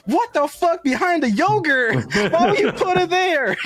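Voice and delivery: hammed-up shout of disbelief; mock group outcry. Men on the hotline shouting at the wife, mock outrage. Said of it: "five men voice at the same time".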